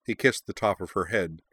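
usual speaking voice

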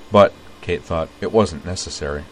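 usual speaking voice

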